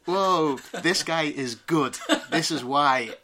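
high, loud and laughing, slowed right down; amazed laughing delight. Retold or imitated. Fan replays meeting his accent coach, laughing in awe. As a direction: gush at how good he is. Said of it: "and I was like".

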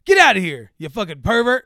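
imitated irate dad confronting a stranger; angry confrontation. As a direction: act out chasing off a creep. Stranger confronts man at playground.

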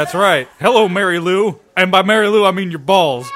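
usual speaking voice

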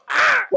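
very high loud cry; mock yelp of pain. Arm grabbed in the joke, very high loud yelp.

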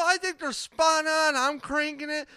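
sarcastic mock voice. Cohost mocks the radio show with a sarcastic put-on voice. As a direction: pretend to love the show, mockingly.

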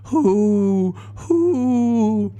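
very high, slow, eerie moaning; ghostly wail. Acted. Dead woman's ghost wailing for her stolen money. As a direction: wail it from far away like a ghost. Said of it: "the ghost of the dead woman cried".

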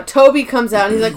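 normal talking voice

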